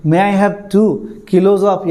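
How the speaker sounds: very slow, spelled-out customer request; slow shop request. Pretend. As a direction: ask the florist slowly, word by word. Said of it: "फूल का दुकान में जाके बोलेंगे". (फूल वाले से धीरे-धीरे माँगना)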